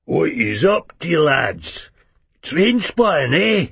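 put-on high, slurred old wino voice; drunk old man. A narrator's reading. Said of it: "An old drunkard".

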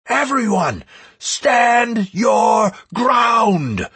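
high, slow rallying cry; thundering shout. A narrator's reading. Raid leader rallying the whole raid, thundering shout. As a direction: stretch out each word in a rousing battle cry. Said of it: "Thundering Shout".

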